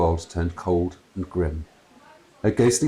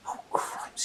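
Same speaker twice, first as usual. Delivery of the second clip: unvoiced muttering under breath; whispered dismay; muttered; 10 dB quieter than usual. A narrator's reading. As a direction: mutter it to yourself. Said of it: "she muttered".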